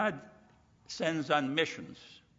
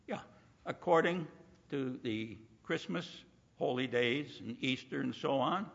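ordinary talk

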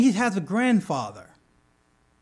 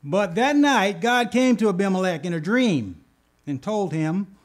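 normal talking voice

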